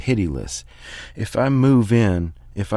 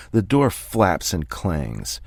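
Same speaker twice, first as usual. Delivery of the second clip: plain reading voice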